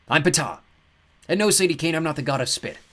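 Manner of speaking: high, bright-sounding god voice; breezy and amused; cheerful god. A narrator's reading. God apologises to Sadie, light and high.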